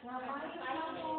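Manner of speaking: gentle asking. Shown as a demonstration. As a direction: ask "Ngapain kamu?" slowly and kindly. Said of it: "kalau guru yang sudah men".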